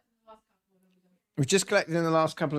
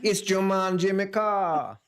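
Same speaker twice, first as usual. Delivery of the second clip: slow, higher mock Jamaican accent; 4 semitones higher, slower than usual. Shown as a demonstration. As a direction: play up the Jamaican lilt, drawn out. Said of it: "say this in a Jamaican accent".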